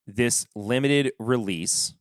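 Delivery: usual speaking voice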